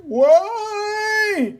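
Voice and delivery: shouting. Acted. Crowd beating a suspect.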